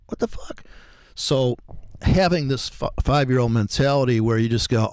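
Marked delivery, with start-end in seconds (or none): 0.1-0.6 breathless whispered swear to himself; whispered curse. Retold or imitated. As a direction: hiss it under the breath, overwhelmed. Panicked inner thought, quoted.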